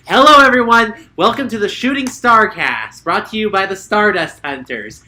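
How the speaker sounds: host welcoming listeners to the podcast; podcast host intro. Acted. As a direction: open the show like a host.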